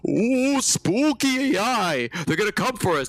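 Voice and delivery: mock doom-mongers with a spooky "ooh". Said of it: "everybody who talks about".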